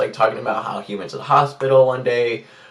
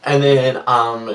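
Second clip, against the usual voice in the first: ordinary talk, nothing marked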